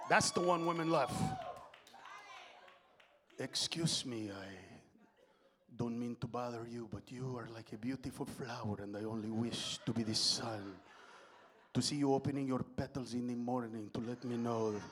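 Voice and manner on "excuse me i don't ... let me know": put-on woo them deep and smooth in a Castilian accent; Spain Spanish accent. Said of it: "from Spain".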